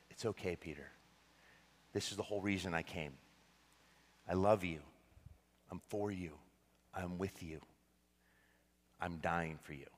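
low, soft and unhurried, with space between phrases; hushed, loving reassurance; tender reassurance. Acted. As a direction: speak gently, as Jesus comforting a friend who failed. Voicing Jesus's loving look at Peter.

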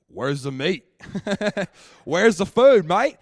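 loud, high Australian bloke demanding his food; hammed-up Australian man yelling for meat; Aussie bloke. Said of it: "all these Aussie men are like".

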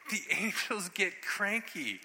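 high, slow, drawn-out punchline; emphatic warning. Real, not acted. Repeats punchline emphatically after hush.

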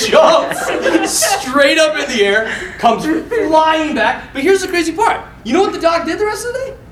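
normal talking voice

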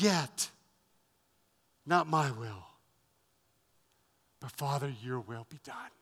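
a hushed, yielding prayer to the Father; hushed surrender. Acted. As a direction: let the prayer sink to a hush of surrender.

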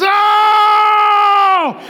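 high, long, sustained shouted "Oh"; exuberant believer's "Oh". Shown as a demonstration. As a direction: belt out the exclamation in awe. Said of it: "We read it like this".

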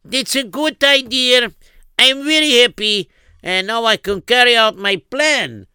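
scheming prince. Acted. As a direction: gloat like a greedy scheming prince. Scheming prince voiced in story.

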